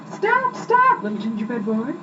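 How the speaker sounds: high-pitched shout of stop stop. A narrator's reading. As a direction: call after the runaway gingerbread boy.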